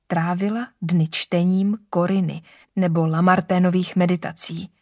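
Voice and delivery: usual speaking voice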